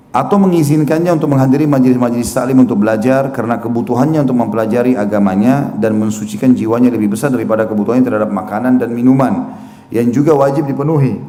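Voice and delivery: ordinary delivery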